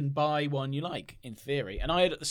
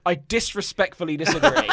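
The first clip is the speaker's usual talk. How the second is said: laughing; 5 semitones higher, 7 dB louder than usual. Real, not acted. Jokey retort in a friendly spat.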